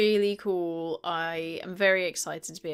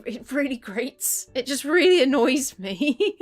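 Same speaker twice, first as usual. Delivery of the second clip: loud and higher, really fed up; exasperated; 5 semitones higher, 8 dB louder than usual. Real, not acted. Complaining to viewers about Red's new voice, loud and exasperated. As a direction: let the annoyance burst out.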